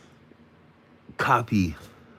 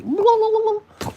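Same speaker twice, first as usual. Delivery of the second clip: squeaky gibberish; 5 dB louder than usual. Retold or imitated. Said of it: "That's what I was feeling".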